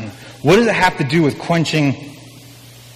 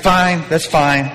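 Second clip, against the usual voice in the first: resigned; 3 semitones higher, much slower than usual. Acted. Jesus resigned.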